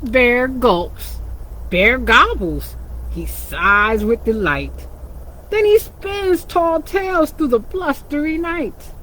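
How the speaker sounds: lively storybook reading for little ones; animated picture-book narration; reading to children. A narrator's reading. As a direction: read it like storytime with kids.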